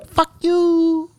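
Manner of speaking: very high squeaky curse; squeaky panda insult. Retold or imitated. Panda cursing the stick man, squeaky. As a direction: squeak it out defiantly. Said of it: "He's like".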